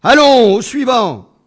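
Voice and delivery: loud, high call to the waiting room. Acted. Doctor calls the next patient from his chair, loud call. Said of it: "il cria à nouveau".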